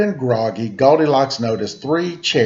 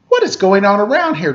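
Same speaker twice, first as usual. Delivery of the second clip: high, loud exasperated groan; a tired girl fed up and loud; lightly voiced exhausted girl groaning; 8 semitones higher, 4 dB louder, faster than usual. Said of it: "groaned the exhausted girl".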